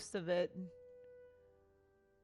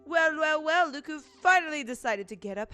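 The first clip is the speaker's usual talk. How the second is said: louder, higher teasing character; 6 semitones higher, 6 dB louder than usual. Acted. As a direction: tease him for waking late. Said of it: "make voices for every single character".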